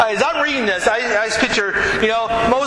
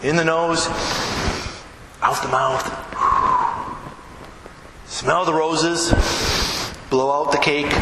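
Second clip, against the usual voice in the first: calm breathing guide; 4 semitones lower than usual. Shown as a demonstration. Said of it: "leads breathing exercise".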